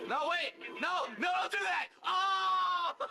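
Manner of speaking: rising panicked yells of no, loud and high; panicked shouting. Real, not acted. Gamer yelling at his character not to do it, panicked shout. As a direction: shout at the game as it goes wrong. Panicking as the game goes wrong.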